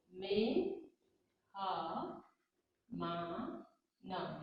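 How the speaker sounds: मे-ह-मा-न split into slow separate syllables. Shown as a demonstration. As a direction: dictate मे-ह-मा-न one slow syllable at a time. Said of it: "मेहमान लिखना आपको".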